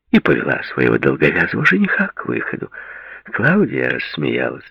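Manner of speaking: ordinary delivery, nothing marked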